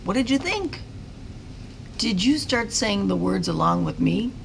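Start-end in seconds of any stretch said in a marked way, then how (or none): none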